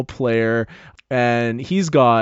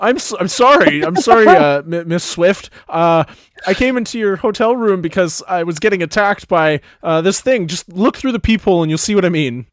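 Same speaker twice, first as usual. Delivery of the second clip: flustered; 6 semitones higher, 6 dB louder than usual. Acted. Scared jock apologizing to star.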